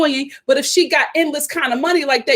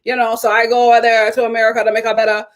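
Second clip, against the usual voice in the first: mock Belizean accent, lightly done; a hint of Harris's accent, rushed; mocking Harris's confessional in a weak Belizean accent; imitating Harris; 3 semitones lower, faster than usual. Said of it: "in the confessional".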